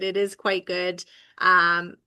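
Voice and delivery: ordinary delivery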